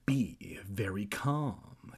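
slow, soft instruction; drawn-out hush; hushed calming. A narrator's reading. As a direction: soothe very slowly and quietly. Kermit soothes Evan in the story.

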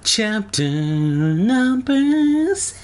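very slow, high, sung-out chapter heading; theatrical chapter announcement. Acted. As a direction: stretch every word, climbing to a high held note.

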